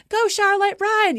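very high, loud sideline yell; retold scream to a running player; screaming encouragement. Coach in the background of a call, screaming.

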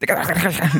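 rapid-fire imitation of his own dialect; laughing burst of nonsense syllables; mock accent gibberish. Shown as a demonstration. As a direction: rattle off harsh gibberish with a laugh. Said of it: "that's how I sound like".

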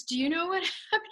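high, quick, laughing through it; can't stop giggling as he asks; laughing question. Retold or imitated. Tess asking Charlie what happened, laughing high.

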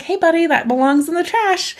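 put-on high, sing-song voice to a small kid; gentle parent to child. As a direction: coax a little one kindly. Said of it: "you'd be like".

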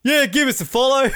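high, loud broad Aussie bloke; broad Australian. Retold or imitated. Cedric plugging his socials, high hammed-up Aussie. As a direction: ham up the cheeky Aussie plug. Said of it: "Cedric was like".

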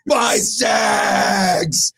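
high, wailing victory cry; imagined fan celebration. Pretend. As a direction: cheer for your team in mock ecstasy. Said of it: "that'll be me like".

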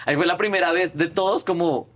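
normal talking voice

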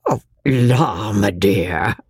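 low, good-humoured foppish drawl. A narrator's reading. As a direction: answer lightly in a low drawl. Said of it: "he rejoined good-humouredly".

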